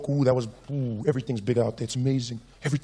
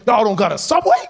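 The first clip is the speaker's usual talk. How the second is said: loud, high mock disbelief; incredulous American stranger; 4 semitones higher, 7 dB louder than usual. Train rider hearing Africa has no subway, loud mock incredulity. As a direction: blurt it out in hammed-up American astonishment.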